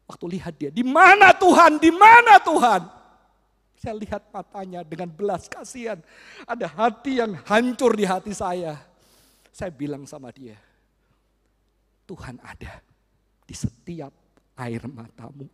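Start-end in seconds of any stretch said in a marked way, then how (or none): 0.7-3.0 slow, rising to a loud high wail; a boy's anguished, drawn-out plea; desperate cry. Acted. Repeated cry of boy. 12.1-15.5 soft, slow comforting; tender reassurance. Acted. Comforting the crying boy.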